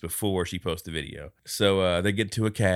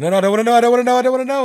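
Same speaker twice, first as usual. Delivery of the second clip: much higher, loud, frantic repeating; panicked yell; 12 dB louder, much faster than usual. Retold or imitated. Retelling the movie, he yells his frantic denial.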